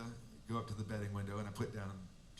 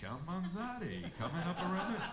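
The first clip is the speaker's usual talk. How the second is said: excited race call; 6 semitones higher than usual. Retold or imitated. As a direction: call the race as the horse surges, building to a shout. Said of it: "he's just like".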